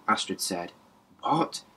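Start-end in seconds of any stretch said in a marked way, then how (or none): 1.2-1.6 high sharp "What?" at her; snapped question. Acted. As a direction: snap at her in disbelief. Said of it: "Quinn snapped at her".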